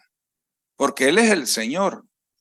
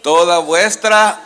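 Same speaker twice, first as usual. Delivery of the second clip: call and response; 3 semitones higher, 9 dB louder, slower than usual. Shown as a demonstration. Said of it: "digan conmigo".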